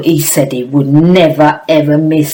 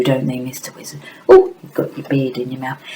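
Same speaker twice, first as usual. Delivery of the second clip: normal talking voice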